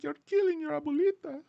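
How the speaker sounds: Mexican abuelita. Retold or imitated.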